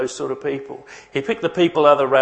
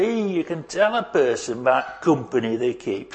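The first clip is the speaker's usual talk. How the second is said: put-on light Yorkshire accent, dropping the "the". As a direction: quote gran in a mild Yorkshire accent. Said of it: "in that sort of accent".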